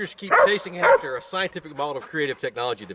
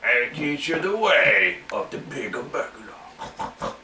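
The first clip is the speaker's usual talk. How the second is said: wise master voice; 4 dB quieter, much slower than usual. Acted. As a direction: speak like an ancient sensei. Mock-wise master line.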